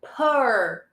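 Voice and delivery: "per" held as a long r-coloured "pur"; isolated per sound. Shown as a demonstration. Teacher isolates the sound for students, modelled per. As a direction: draw out "per" like "purr". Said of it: "The sound is".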